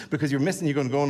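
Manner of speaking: ordinary delivery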